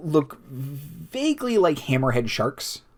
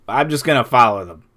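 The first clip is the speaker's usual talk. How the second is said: slightly raised, quick call; mild yell; 5 dB louder, faster than usual. Acted. Pilot yells out from the helm, a mild yell. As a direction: call it out briskly, half-yelled. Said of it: "yells out".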